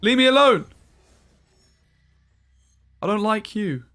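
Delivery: real angry yelling. Real, not acted. Streamer raging at the same opponent again, loud angry yelling. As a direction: yell it at a rival you can't escape. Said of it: "I'm actually getting so mad right now".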